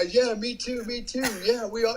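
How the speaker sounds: mocking whiny me-too voice; mock chorus. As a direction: parody them eagerly chiming in. Mocking the other guys agreeing.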